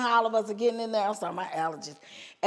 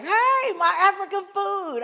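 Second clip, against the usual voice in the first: high, loud eager voice; lady's high, loud excited offer; 10 semitones higher, 6 dB louder than usual. Retold or imitated. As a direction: call out the offer with glee. Lady excitedly offering food.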